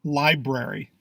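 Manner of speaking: Library said LIE-brair-ee; both Rs sounded. Shown as a demonstration. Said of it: "first R in this word is silent".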